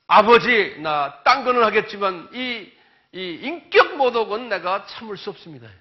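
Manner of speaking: loud crying-out prayer; anguished plea. Retold or imitated. Preacher voicing Jesus crying to the Father, loud anguish.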